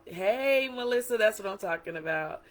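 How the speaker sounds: a friend hyping her up, excited cheer. Retold or imitated. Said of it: "Some people thought".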